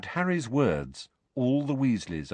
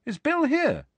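put-on curious boy's question. Harry's acted question, pitched up.

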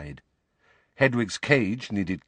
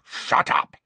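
growled; 2 semitones higher, 4 dB louder than usual. A narrator's reading. Old guard silencing Harry before the name, growled. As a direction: growl it out sharply. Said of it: "Moody growled".

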